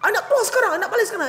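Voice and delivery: high demanding mimicry; mock huffy guest. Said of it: "Dia cakap". (meniru tetamu merajuk dengan suara tinggi)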